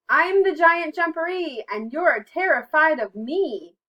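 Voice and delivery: very loud, high mock-giant boast; full-volume storybook menace. A narrator's reading. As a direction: boom it out to scare, slowing at the end. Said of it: "came the loud voice".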